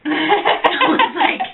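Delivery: real giggles, drawn out; laughing. Real, not acted. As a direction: laugh out the lead-in. Laughing at guy hitting on her.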